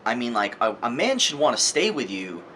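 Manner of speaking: normal talking voice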